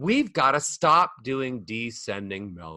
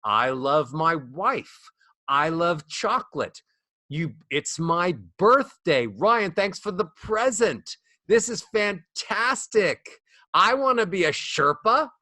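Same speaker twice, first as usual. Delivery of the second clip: slow, climbing higher line by line; rising happy melody; 3 semitones higher than usual. Shown as a demonstration. Coach showing an ascending, upbeat melody. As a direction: lift it up, brighter each time. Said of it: "melodies that go up".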